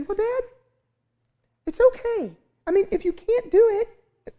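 very high, childlike imitation of his daughter; mock little-girl voice, way up high; high squeaky daughter voice. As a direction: squeak it out like a cartoon mouse. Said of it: "I don't know why I'm talking like that".

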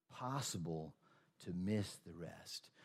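possible to miss the rest, each word slowly weighted; slow, quiet repeat. Shown as a demonstration. Preacher repeating the warning so the congregation takes it in. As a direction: let the warning sink in word by word.

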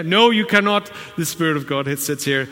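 loud, high indignant no; a preacher acting out a strange believer guarding the Spirit's seat; mock weird refusal. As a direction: ham up a prim refusal. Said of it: "Please don't be weird about it".

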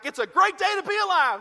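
high, loud gushing joy; mock-cheerful worker greeting the day; overjoyed. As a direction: bubble over with delight.

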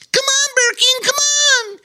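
an otter urging the bear to hurry. Acted. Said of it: "exhorted Bobo Chico".

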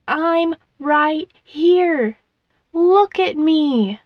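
slow, high, insistent plea; drawn-out cry for attention; desperate pleading. A narrator's reading. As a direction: stretch each word out, begging to be noticed. Child begs parents to notice her.

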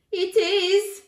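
put-on high, loud child voice; insistent boy. A narrator's reading. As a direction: insist like an alarmed small boy. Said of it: "declared the boy".

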